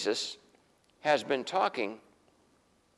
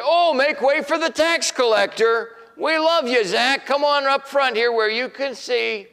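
much higher and louder, overdone fake cheer; sarcastic mock welcome; 10 semitones higher, 8 dB louder than usual. Crowd pretending to greet the tax collector, mock cheer. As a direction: ham up a welcome nobody would give.

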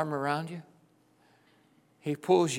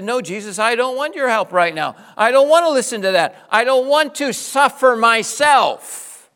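higher, louder, building defiant refusal to Jesus; voicing the congregation's stubborn no to Jesus, much louder and higher; 9 semitones higher, 10 dB louder than usual. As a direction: push Jesus away, rising in protest. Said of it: "We've said".

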